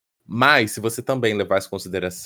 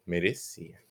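much softer, lower, flat verdict; quiet answer; 4 semitones lower, 11 dB quieter than usual. Acted. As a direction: drop it under your breath. Answers her own question.

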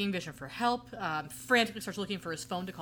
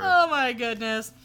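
mock sitcom laugh; 6 semitones higher, 10 dB louder, slower than usual. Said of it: "That's such a knee slapper".

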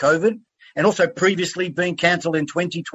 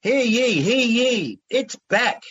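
town crier; 7 semitones higher, slower than usual. Acted. Host opens show proclaiming.

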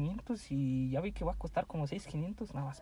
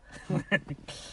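laughing. Real, not acted. Laughing at crude joke.

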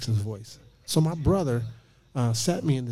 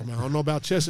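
normal talking voice

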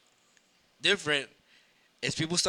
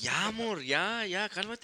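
put-on higher-pitched girlfriend pleading for calm; raised voice, imitating a girlfriend; imitated calming girlfriend; 6 semitones higher than usual. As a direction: lightly voice a girlfriend soothing her man. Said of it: "his girlfriend tries to calm him down".

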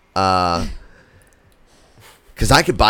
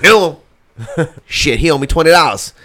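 put-on much higher and louder, indignant old man; cranky old pastor; 8 semitones higher, 7 dB louder than usual. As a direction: squawk it like an outraged ancient preacher. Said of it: "He was like".

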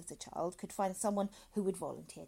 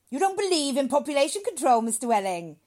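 loud, high, stern challenge; severe; 7 semitones higher, 16 dB louder than usual. Acted. Doctor towers over reluctant father, severe and loud. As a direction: come down hard on him. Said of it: "became rather severe with Welling".

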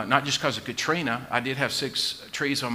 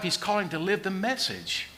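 ordinary delivery

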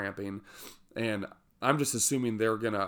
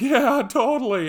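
much higher, much louder, strained yelp; terrified squeak; 11 dB louder than usual. Retold or imitated. Shaken climber answering the rescuer after his fall, panicked squeak. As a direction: squeak out agreement in pure panic.

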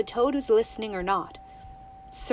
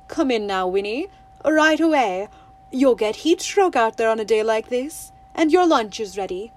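lightly voiced mother, firm and louder; firmer mother's voice; 6 dB louder than usual. Mother calling Winnie in from the window, firm. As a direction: call her in like a no-nonsense mum. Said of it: "another, firmer voice, her mother's".

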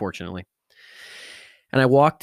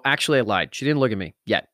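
ordinary talk

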